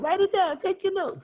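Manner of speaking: a high squeaky take on the teacher's instruction; imitating the superheroine. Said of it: "That's how she talks".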